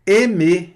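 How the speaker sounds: Aimer said as eme, closed e, silent r; French aimer. Shown as a demonstration. Teacher modelling Aimer for students to repeat. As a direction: model Aimer clearly for repetition. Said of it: "esse A mais I dá E".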